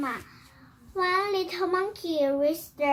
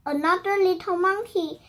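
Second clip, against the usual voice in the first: ordinary delivery, nothing marked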